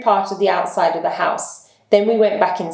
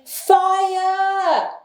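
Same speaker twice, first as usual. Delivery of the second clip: full-voiced high yell of fire; shouted; 12 semitones higher than usual. Holmes and policemen shouting fire to flush a man out, a high full yell. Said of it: "we all shouted".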